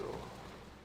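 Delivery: quiet breathy sigh; soft tired sigh. Acted. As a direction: let out a weary sigh. After farewell.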